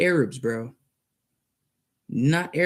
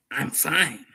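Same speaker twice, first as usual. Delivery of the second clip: normal talking voice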